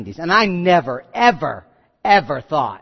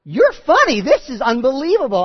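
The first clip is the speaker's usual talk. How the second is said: high, louder burst of delighted disbelief; joyful amazement; 6 semitones higher, 4 dB louder than usual. Acted. Sarah now laughing with God, joyfully amazed. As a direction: marvel happily, pitched well up. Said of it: "Now she's laughing with God".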